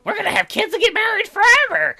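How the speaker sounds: very high, loud squeal; over-the-top squeaky excitement; gushing mockery. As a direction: mimic a lovestruck squealer. Mocks lovestruck character.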